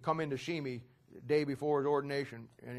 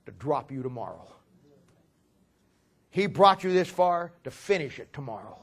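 louder preaching, pressing the point; rising pulpit delivery; building sermon; 7 dB louder than usual. Acted. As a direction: start to preach it to the young man. Preacher warming to his point.

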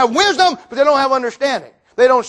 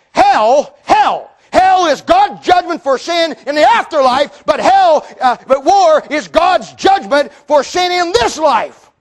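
fiery preaching; 5 semitones higher than usual. Real, not acted. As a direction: build to a shout on hell, slowing on the judgment. Preaching at full force.

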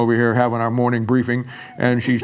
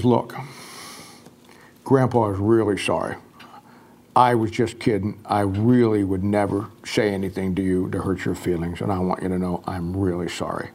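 tender apology; 6 semitones lower, 5 dB quieter than usual. Retold or imitated. Grandpa apologizes to granddaughter.

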